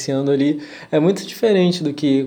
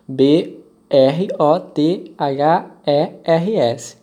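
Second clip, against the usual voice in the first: slow, letter by letter; spelling it out; much slower than usual. Shown as a demonstration. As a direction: spell each letter clearly. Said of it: "vou soletrar pra vocês".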